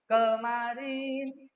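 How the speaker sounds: Kemarin very slow, KE strong and the rest weak; stress on KE. Shown as a demonstration. Teacher modelling stress in Kemarin for the class, drawn out slowly. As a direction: stretch out Kemarin, hitting KE hard. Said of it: "ke-nya kuat".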